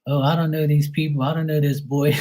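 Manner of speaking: high imitated old-aunt voice; great-aunt quote. Great-aunt answers grandma about the boy, high mock voice. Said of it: "And then she's like".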